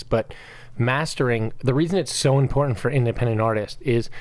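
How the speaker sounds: ordinary delivery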